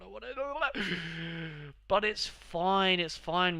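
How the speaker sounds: high, slow, strained "it's fine"; sarcastic. Real, not acted. Gamer pretending a bad result is fine, high and slow. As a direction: force out a fake "it's fine".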